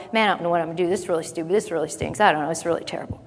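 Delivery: low, soft defeated muttering; mopey grumbling. Retold or imitated. Worshipper moping around the house, muttering defeat. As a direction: sigh it out like a hopeless complainer. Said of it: "walked across the floor of your house going".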